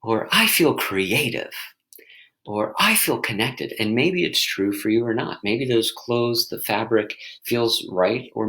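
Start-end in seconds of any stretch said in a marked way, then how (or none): 0.3-1.5 light lift. Shown as a demonstration. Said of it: "So also try on". 2.8-3.7 gentle lift. Shown as a demonstration. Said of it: "So also try on".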